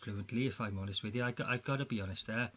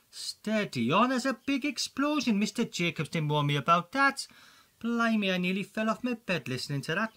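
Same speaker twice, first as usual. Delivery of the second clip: flustered excitement; 7 semitones higher, 5 dB louder than usual. Acted. Reacting to explosion sound.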